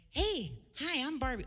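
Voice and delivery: high-pitched doll voice, cheery greeting; Barbie. Acted. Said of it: "I was the voice of Barbie".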